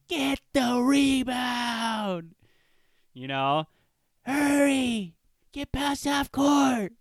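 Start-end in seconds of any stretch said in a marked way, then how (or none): 0.1-2.3 high, drawn-out sideline holler; aunties yelling. Retold or imitated. Aunties at the basketball game, imitated high yelling. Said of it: "they're just yelling". 4.2-6.9 high, drawn-out sideline holler; aunties yelling. Retold or imitated. Aunties at the basketball game, imitated high yelling. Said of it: "they're just yelling".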